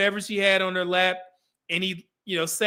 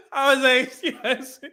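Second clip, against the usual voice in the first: laughing through words; 6 semitones higher than usual. Real, not acted.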